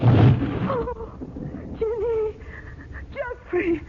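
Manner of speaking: put-on breathless, broken lament over the vanished girl; soft and trembling, close to tears; a hushed, weeping cry of loss; tearful. Girl has just vanished.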